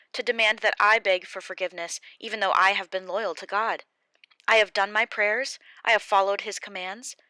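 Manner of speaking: loud, hurt rebuke of friends; angry Job. Acted. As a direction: turn on the friends who blame him.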